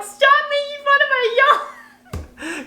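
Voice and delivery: very high, drawn-out comic wail; mock upset whine. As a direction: whine in exaggerated protest, laughter creeping in. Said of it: "she got mad at me".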